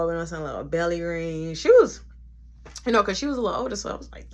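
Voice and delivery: ordinary talk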